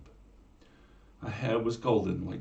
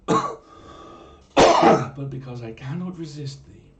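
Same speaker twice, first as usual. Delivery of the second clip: slow, loud swooning confession; hammed-up lovestruck; 6 semitones higher, 13 dB louder, much slower than usual. Hero confesses he cannot resist the mermaid, swooning. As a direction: overplay the smitten hero.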